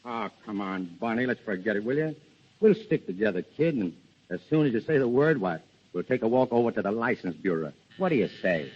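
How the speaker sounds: soft, low plea to marry; tender coaxing. Acted. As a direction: win her back gently. Making up with Bonnie.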